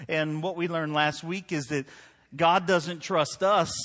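full-force pulpit delivery; preaching. Acted.

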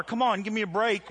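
ordinary delivery

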